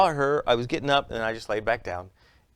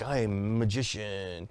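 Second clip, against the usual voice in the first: pompous magician; 2 semitones lower, 5 dB quieter than usual. Retold or imitated. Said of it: "that tries to be like".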